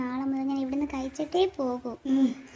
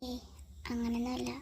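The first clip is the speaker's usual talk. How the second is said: much softer, hushed voice; 12 dB quieter than usual. Acted. A girl wondering about a late call from Ram, hushed and subdued.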